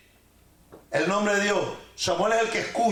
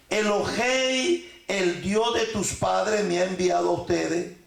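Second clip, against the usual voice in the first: solemn slow reading; 2 semitones lower, slower than usual. Acted. Quoting scripture, drawn out.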